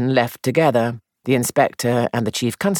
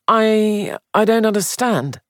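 nervous gentleman voice; 9 semitones higher than usual. Acted. Sandford protests, bewildered.

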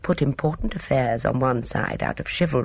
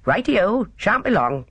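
higher-pitched, breezy porter's reply; cheerful porter; 6 semitones higher than usual. A narrator's reading. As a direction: answer cheerfully, lightly in character. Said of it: "The porter said cheerfully".

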